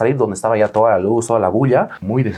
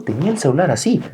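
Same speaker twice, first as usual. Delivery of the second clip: ordinary talk, nothing marked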